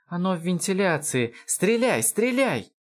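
high, panicked yell; screaming. A narrator's reading. Lound ordering Van to fire, high yell. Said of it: "в тон ему завопил и Лоунд".

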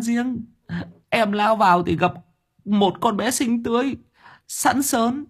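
very high, whining confession; tearful whimper. A narrator's reading. Sáu Bưởi explaining last night to boss, tearful. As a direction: blubber through a sheepish account. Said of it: "Sáu Bưởi mếu máo".

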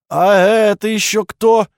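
high, loud, drawn-out demand with hysterical notes; hysterical loud question. A narrator's reading. Duke on the laptop screen startled by a stranger, loud and hysterical. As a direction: ask it slowly, loudly, on the edge of hysteria. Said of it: "Дрожащий мужской голос, в котором явно слышались истеричные нотки, громко спросил".

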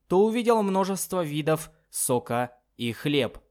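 usual speaking voice

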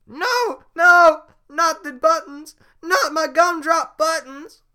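very high, loud shrieking; panicked scream. Acted. Gingerbread man begging.

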